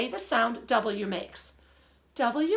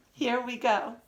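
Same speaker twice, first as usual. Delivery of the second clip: usual speaking voice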